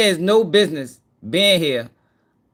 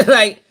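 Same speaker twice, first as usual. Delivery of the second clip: laughing; 6 semitones higher, 8 dB louder than usual. Real, not acted. Laughing at clip.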